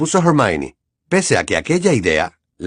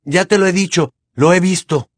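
raised insistence; 7 semitones higher, 3 dB louder than usual. Acted. Harry insisting heatedly.

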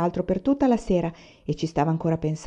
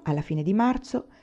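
ordinary delivery, nothing marked

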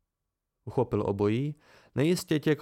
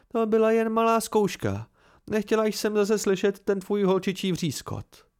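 higher, louder mocking Mola; teasing woman's voice; 7 semitones higher, 3 dB louder than usual. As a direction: needle him with a sharp tease. Mola taunts the narrator. (posměšná žena škádlí vypravěče)

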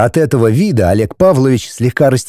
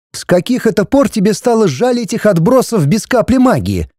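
high-pitched, mildly cross wife; angry; 9 semitones higher than usual. A narrator's reading. Wife scorning villagers to husband, mildly angry. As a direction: challenge him in a raised pitch. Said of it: "сердито уставилась на него жена".

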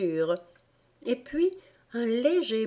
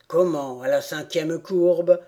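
indignant rat; 2 semitones lower than usual. A narrator's reading. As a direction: throw her words back at her. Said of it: "absolument fâché cette fois".

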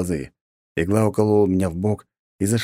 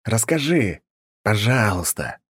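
hissed; 4 semitones higher, slower than usual. A narrator's reading. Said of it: "прошипел я".

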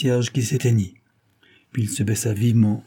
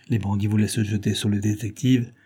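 ordinary delivery, nothing marked

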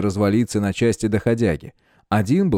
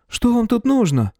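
high nervous voice; 13 semitones higher than usual. A narrator's reading. Neighbour demands.

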